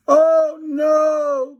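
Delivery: high, loud frightened cry; scared cry. A narrator's reading. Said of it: "cried Fluffy".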